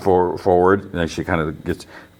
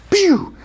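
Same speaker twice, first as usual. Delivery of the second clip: very high-pitched "pew"; playful falsetto pistol sound effect; imitated gunshot. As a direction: squeal out a toy laser shot. Imitates second pistol shot.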